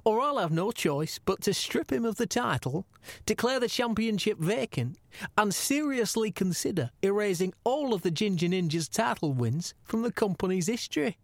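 put-on accent for the commissioner's message; Gary Shagg. Host relays the commissioner's ultimatum to the champ, in an imitated accent. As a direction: read it out in an imitated boss's accent. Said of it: "do it in my best Gary Shagg accent".

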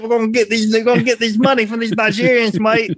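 bad British-Australian accent. Co-host plotting to get money from Nigerian scammers, bad British-Australian accent. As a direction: do a silly, muddled Aussie-British accent. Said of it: "that U K or is that Australia?".

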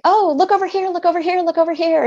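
high, loud mock distraction; over-eager parent steering a sad child elsewhere; cheering them up. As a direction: sing out brightly to distract a child. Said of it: "we try to cheer them up".

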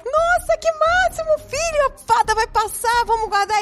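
high, bright-eyed fake enthusiasm; fake excited mom. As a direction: sell it like a cheerful mom covering up. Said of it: "Aí eu". (mãe fingindo empolgação, voz bem aguda)

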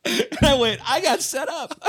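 said high through laughter; laughing. Real, not acted. Pastor telling the host how he got set up, laughing. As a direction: push the punchline out while laughing.

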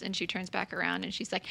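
ordinary talk, nothing marked

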